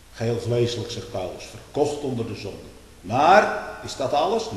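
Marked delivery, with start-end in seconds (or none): 3.0-4.5 sudden loud question; raised voice. Acted. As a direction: lift the voice sharply on the question. Preacher rising to question. (luide, uitroepende vraag)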